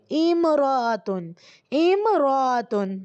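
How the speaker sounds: امراتن drawn out slowly, im-ra-tun; slow model word. Shown as a demonstration. Arabic teacher models امراتن for her class, slowly. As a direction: say امراتن very slowly, each syllable clear. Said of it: "عورت کو کیا بولتے ہیں عربی میں".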